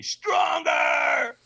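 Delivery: high, loud scream in the face. Retold or imitated. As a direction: scream it right in his face. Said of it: "gets in my face and screams at me".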